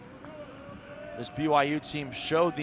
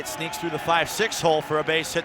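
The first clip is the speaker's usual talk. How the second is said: excited call; 3 semitones higher, 5 dB louder than usual. Real, not acted. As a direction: ride the base hit with rising excitement. Base hit call in live broadcast.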